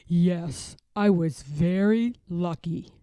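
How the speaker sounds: put-on a gentle old bear showing an old photo; Grandfather Bear. Grandfather Bear showing his grandson a photo, fond old bear voice.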